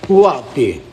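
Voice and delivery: a loud call to absent viewers. Real, not acted. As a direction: shout it out to whoever's listening. Calling out to the live chat.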